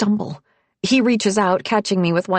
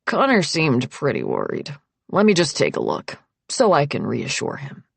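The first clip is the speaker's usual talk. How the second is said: Nick, reassuring doctor; 4 semitones lower than usual. A narrator's reading. Nick asking to look at her hand, low and reassuring. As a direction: lower male character voice, persuasive.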